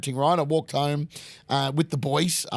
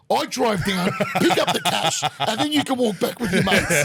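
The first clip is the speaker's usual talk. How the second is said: laughing; 6 semitones higher, 3 dB louder than usual. Retold or imitated. Retelling dad's plan, laughing.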